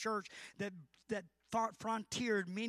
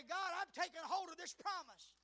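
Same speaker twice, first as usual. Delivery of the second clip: higher, rising into earnest prayer; a prayer building up in intensity; fervent prayer voice; 8 semitones higher than usual. Acted. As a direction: pray it with growing fire. Voicing a prayer to God.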